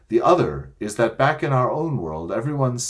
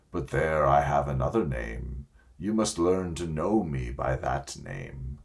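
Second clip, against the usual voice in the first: lower, grave and kindly lion voice; Aslan the great lion; 5 semitones lower, 4 dB quieter than usual. A narrator's reading. Aslan promising the children the way home, low and gentle. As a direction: speak low and calm like a wise lion king.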